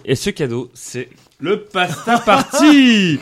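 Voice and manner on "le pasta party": slow, drawn-out showman reveal; grand announcement. Acted. Host unveiling the prize to the guests, slow showman announcement.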